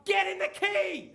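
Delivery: high, loud, slow courtside yell; yelling from the stands. Retold or imitated. Dad yelling to his daughters on court, loud and slow. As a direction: bellow it down to the court like a sideline parent. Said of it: "I'm yelling at Miley and I'm yelling at Georgia".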